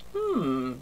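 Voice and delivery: a father weighing his drawing, hmm; musing father. A narrator's reading. Father pondering his drawing, low musing hum. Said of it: "zei hij".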